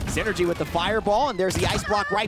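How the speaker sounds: loud, high hype play-by-play; esports caster. Real, not acted. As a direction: call the play at full volume. Live play-by-play fight.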